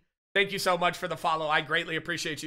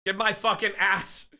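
ordinary delivery

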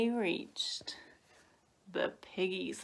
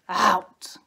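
growled order; 11 dB louder than usual. A narrator's reading. Dad sends sons hunting, loud growl. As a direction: growl it as a cross father wolf. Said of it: "growled Dad".

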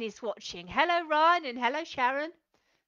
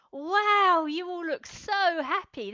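delighted wow; 5 semitones higher, 5 dB louder, slower than usual. Acted. Praising children's happy faces.

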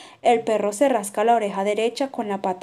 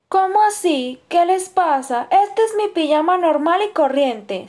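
defensive; 6 semitones higher, 4 dB louder than usual. A narrator's reading. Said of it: "se defiende el canguro".

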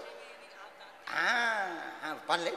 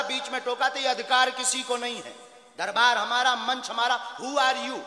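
high-pitched indignant rebuke; real angry scolding; 5 semitones higher, 7 dB louder than usual. Real, not acted. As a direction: assert your authority over an intruder. Angry scolding of interrupting reporter.

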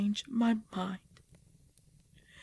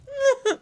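high pained laugh; 6 dB louder than usual. Acted. Baby fighting inside her.

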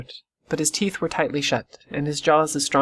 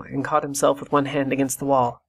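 ordinary delivery, nothing marked

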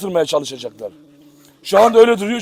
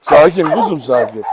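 ordinary talk, nothing marked

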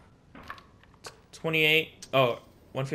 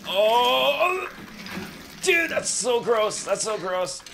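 disgusted cry; 9 semitones higher, slower than usual. Real, not acted. Gamer sees something gross, disgusted cry. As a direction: recoil in disgust.

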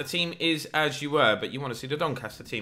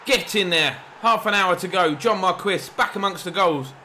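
celebrating; 6 semitones higher, 4 dB louder than usual. Real, not acted. Celebrating the goal.

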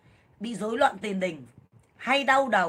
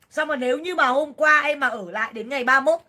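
ordinary talk

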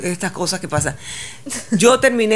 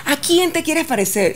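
mocking the coach; 9 semitones higher than usual. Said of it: "Era como".

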